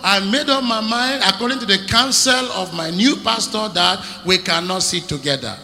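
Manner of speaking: high, loud emphatic protest; loud refusal. Acted. Preacher shouting a past refusal. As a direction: cry out against it.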